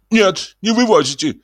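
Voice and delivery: high, pleading cry. Acted. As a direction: beg him not to take it. Iznurenkov begs Ostap. (высокая умоляющая мольба)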